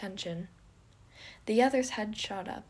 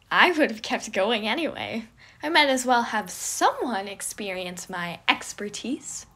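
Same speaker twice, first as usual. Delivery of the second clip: boastful Remus, mocking; 9 dB louder than usual. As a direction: show off to your friend, teasing. Remus voiced loud and boastful.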